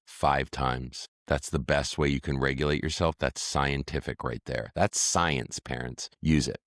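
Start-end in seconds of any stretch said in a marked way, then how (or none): none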